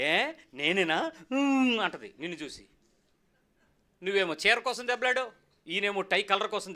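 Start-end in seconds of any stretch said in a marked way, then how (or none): 0.0-1.8 the wife turning on her husband, mildly mocked; wife's retort. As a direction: act out the wife sulking, a light put-on voice. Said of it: "అప్పుడు ఆవిడ చూసి".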